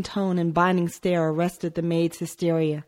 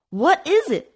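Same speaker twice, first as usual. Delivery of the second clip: alarmed question; 12 semitones higher, 4 dB louder than usual. A narrator's reading. Hysteria rising in the maid.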